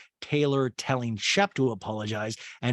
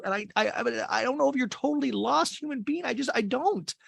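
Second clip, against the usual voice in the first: hammed-up emotional outpouring, pitched way up; mock distraught girlfriend; 11 semitones higher than usual. Exhausted girlfriend confronts boyfriend, high mock-anguished plea.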